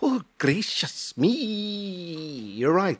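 a hammy Welsh lady voice; Welsh accent. Acted. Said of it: "that was bloody awful".

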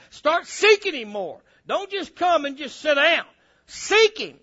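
loud, high, hard-punched preaching; fired-up preaching. Real, not acted. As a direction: preach it out to rouse the church. Preaches at full force.

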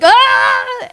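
sudden high yelp of resistance; a high startled shout as she fights it; startled cry. Retold or imitated. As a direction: cry out sharply, high and short. Fighting the Spirit, standing like this.

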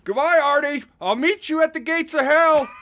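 high-pitched theatrical yell; acted scream. Harry screams after brother Artie running out the door, high acted yell. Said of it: "Harry continued to scream".

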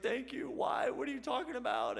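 imitated tearful voice. Caller reacting to a prayer for him, imitated tearful voice. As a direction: imitate a man crying down the phone. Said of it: "I can hear him crying".

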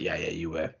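low, soft, dismissive reply; offhand confirmation. Acted. Office man confirming the military past, low and offhand. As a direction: drop it quietly, as if unimportant.

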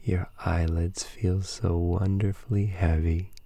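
slow, hushed breathing cues; calm meditative tone. Acted. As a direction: ease them gently into sleep.

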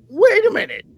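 high-pitched, loud mock disbelief; incredulous protest. Host acting out his reaction to the quarterback's showboating, incredulous. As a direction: halt them in high-voiced disbelief.

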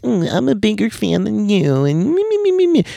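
very high, squeaky mocking voice; mock bragging babble, way up high; bragging superfan. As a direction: mimic a whiny fan one-upping everyone. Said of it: "one of those people who's like".

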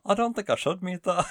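high, lilting mock Irish; bad fake Irish accent. Shown as a demonstration. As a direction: reluctantly try a badly faked Irish accent. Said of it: "really bad fake Irish accent".

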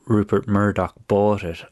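ordinary delivery

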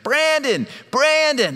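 high, loud call of his name; screaming family. Retold or imitated. Wife and kids calling dad from the kitchen, screaming. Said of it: "They were just screaming in the kitchen".

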